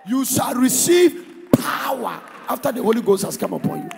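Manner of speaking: loud, rousing sermon calls to the crowd; preaching at full force. Acted. Preacher rousing the congregation, loud and fervent. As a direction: fire up the congregation.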